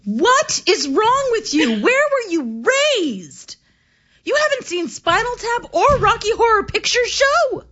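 high, slow, incredulous disbelief; mock outrage. Real, not acted. Scolding cohost for never seeing Rocky Horror, incredulous. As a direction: act scandalised by her ignorance. Outraged friend hasn't seen films.

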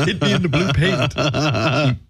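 slow taunt through a laugh; laughing mock taunt. Mocking a Celt warrior.

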